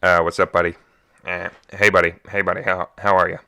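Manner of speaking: loud, lightly voiced dad answering; dad impression. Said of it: "He'll just answer and go".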